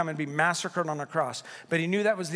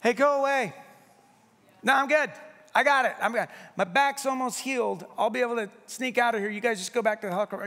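stubborn hostage; 5 semitones higher, faster than usual. Retold or imitated. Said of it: "You're not going like this".

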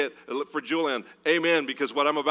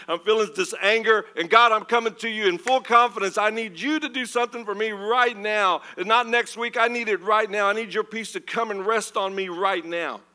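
bold pleading prayer; 4 semitones higher than usual. Acted. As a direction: rush in and insist on it now. Acted bold prayer rushing in.

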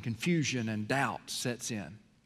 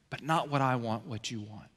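softer, lower, yielding; quiet surrender; 6 semitones lower, 7 dB quieter than usual. Shown as a demonstration. Preacher yielding to God's will, fading to a hush. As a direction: let the voice fall away in surrender.

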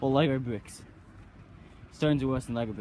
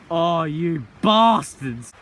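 loud slow groan; 2 semitones higher, 8 dB louder, much slower than usual. Real, not acted. Cursing the stones.

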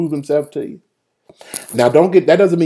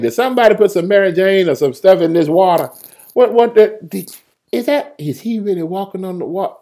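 high, stammering disbelief; bewildered boatmate; 5 semitones higher than usual. Acted. Man in the boat sees water-walking, high baffled questioning. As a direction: question what you see in a high voice. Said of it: "I'd be like".

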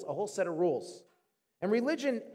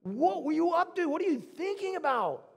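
high-pitched, acted exasperated outrage; Jonah; 8 semitones higher than usual. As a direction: rail at God, stretching out the accusing questions. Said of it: "It's not like he just disagrees".

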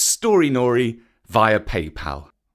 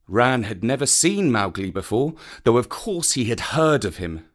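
usual speaking voice

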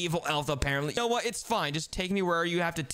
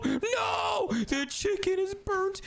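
panicked shout; 12 semitones higher, much slower than usual. Acted. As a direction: wail over the ruined chicken. Chicken burns on the stove.

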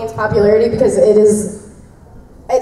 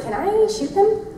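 timid quote; 9 semitones higher, 5 dB quieter than usual. Retold or imitated. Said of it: "And he's like".

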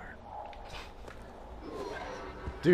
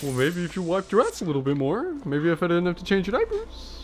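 higher-pitched nagging dad voice; bad-parent impression; 6 semitones higher than usual. Mock father scolding little Billy, nagging. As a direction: nag back at a child, pitched up. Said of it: "that was a good impression".

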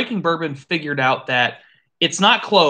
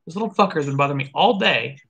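ordinary talk, nothing marked

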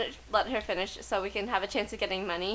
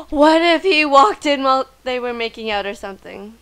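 excited; 3 semitones higher, 12 dB louder than usual. Real, not acted. Excited guess at game scene.

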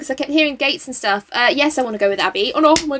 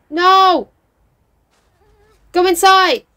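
urgent plea; 6 semitones higher, 6 dB louder, slower than usual. Real, not acted. Pleads with Sim in danger.

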